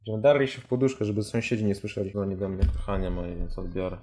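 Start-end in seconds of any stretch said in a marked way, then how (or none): none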